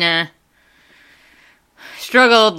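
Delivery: normal talking voice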